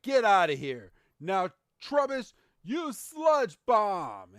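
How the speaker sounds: loud angry order, slowing on the insult; hunter's command. Acted. Hunter orders Trubbish to attack.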